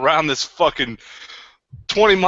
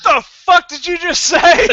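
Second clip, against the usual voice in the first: loud, high and slow, a mock threat; hostile snap; 12 semitones higher, 7 dB louder, slower than usual. Eric answering the kid's compliment, hostile and loud. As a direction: bark it out like he's ready to fight. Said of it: "With that much hostility".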